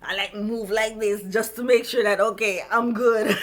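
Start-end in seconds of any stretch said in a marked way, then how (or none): 2.2-3.4 words spilling into real laughter; slow, then breaking into high laughter; laughing. Real, not acted. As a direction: laugh through it as you finish. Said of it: "I'm laughing now".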